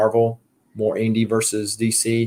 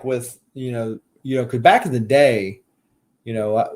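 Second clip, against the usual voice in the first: normal talking voice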